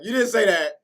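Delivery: high, loud indignant comeback; retold angry protest. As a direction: push back hard at mom. Arguing back at mom, retold.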